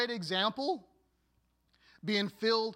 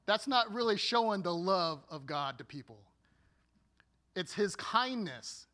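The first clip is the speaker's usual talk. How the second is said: ordinary talk, nothing marked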